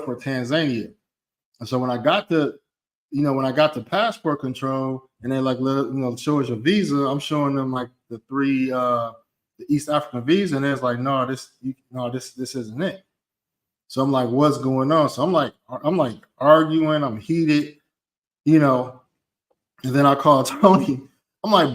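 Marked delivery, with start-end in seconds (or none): none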